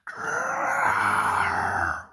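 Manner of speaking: high wailing growl; frustrated wail. A narrator's reading. Mavis stuck and wailing in frustration. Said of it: "wailed Mavis".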